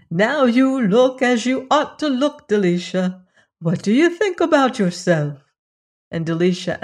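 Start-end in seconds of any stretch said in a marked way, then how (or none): 0.1-5.5 lifted, delighted cry; great delight. A narrator's reading. Fairy admiring the dressed-up princess, delighted. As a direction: admire them with open delight. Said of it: "the fairy with great delight cried".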